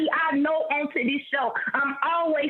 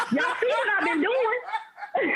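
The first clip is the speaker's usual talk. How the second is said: laughing; 4 semitones higher, slower than usual. Real, not acted. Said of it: "I will neglect all these duties".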